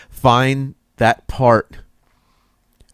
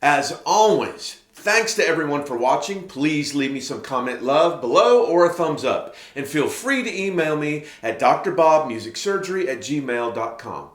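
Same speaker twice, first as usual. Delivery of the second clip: higher-pitched, lilting sign-off read; presenter's closing read, pitched up; scripted outro read; 6 semitones higher, 3 dB quieter than usual. Acted.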